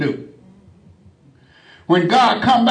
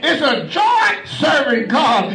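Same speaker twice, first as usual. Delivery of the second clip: raised, shouting sermon voice with a higher pitch; fiery preaching; 7 semitones higher than usual. Acted. Preacher rousing the congregation to serve God, shouted preaching. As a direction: preach it out hard to the whole church.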